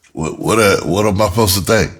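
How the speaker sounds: ordinary delivery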